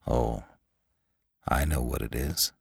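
low, soft, understated reply; quiet. A narrator's reading. Lantry to the puzzled attendant, quietly knowing. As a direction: say it quietly, pitch dropped. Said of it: "said Lantry quietly".

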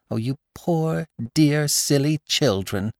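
put-on wife comforting the weeping young bride, tenderly; tender cry. A narrator's reading. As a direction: gather them in with tender pity. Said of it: "my wife cried".

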